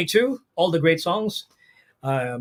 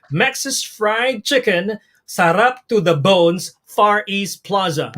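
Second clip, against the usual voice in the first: slow, deliberate; much slower than usual. Shown as a demonstration. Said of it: "this particular passphrase".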